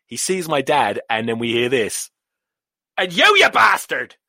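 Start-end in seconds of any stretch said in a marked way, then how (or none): 3.0-4.2 put-on high, loud Irish old man's outburst; Irish accent. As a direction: imitate an angry Irish grandfather yelling. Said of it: "he was yelling at my dad and my stepmother".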